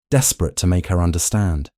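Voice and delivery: ordinary delivery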